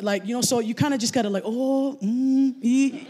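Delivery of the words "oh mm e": higher noncommittal vowel murmurs; feigned understanding. Acted. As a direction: nod along as if you follow. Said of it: "I'm pretending I understand".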